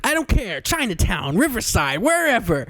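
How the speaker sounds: high, sing-song clueless tourist; breezy mock tourist who doesn't care where; lightly voiced clueless visitor; careless tourist. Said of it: "And he's like".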